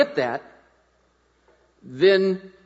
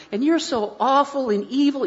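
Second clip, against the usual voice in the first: high cooing insult; sweet baby talk; 6 semitones higher than usual. Shown as a demonstration. Dad cooing insults to his giggling baby. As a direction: coo it like a lullaby. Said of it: "It's more important how you say it".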